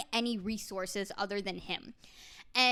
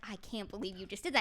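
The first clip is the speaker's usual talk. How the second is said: softer, fast mock despair; fake devastation; 4 semitones lower, 7 dB quieter, faster than usual. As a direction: pretend to be heartbroken, quietly. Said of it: "trying to pretend like she's really devastated".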